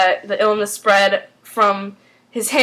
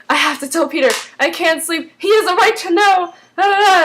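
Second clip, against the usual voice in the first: higher-pitched mock pleading, building; urgent acted quote; 7 semitones higher than usual. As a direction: retell her urgent insistence, voice climbing. Voices an urgent confession in retelling.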